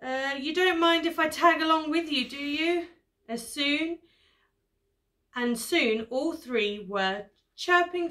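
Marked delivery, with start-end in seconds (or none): 0.0-2.9 higher, lightly acted worried plea; storybook elephant asking anxiously; tentative request with a touch of fear; nervous elephant. A narrator's reading. Scared elephant asks to join them.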